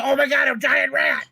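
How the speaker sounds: high-pitched mock yell of alarm. Player distracting the advancing lady with a fake rat, yelling. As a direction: cry out in put-on alarm. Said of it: "And I yell".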